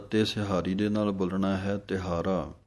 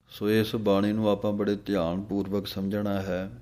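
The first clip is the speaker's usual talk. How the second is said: ordinary delivery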